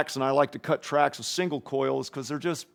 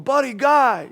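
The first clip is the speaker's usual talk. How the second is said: a higher, louder call of the name; 11 semitones higher, 6 dB louder, slower than usual. Retold or imitated. Bonnie hyping Buddy during the song, higher louder call. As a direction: cheer him on from the mic. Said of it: "she's going".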